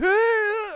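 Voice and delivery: high, loud starstruck squeal; mock fan scream. Joking about acting starstruck, mock fan scream. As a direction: let out a sudden piercing pretend scream. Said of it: "get like, super starstruck and be like".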